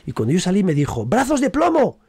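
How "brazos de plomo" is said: high, loud barked stage direction; acting teacher shouting a direction from offstage; teacher's shout. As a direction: call it out from the wings. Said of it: "te gritaba desde, desde fuera del escenario".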